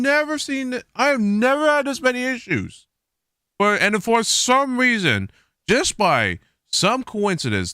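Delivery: high, exasperated disbelief about the issues; fed-up outburst; mad. Real, not acted. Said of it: "I don't know why I'm getting mad right now".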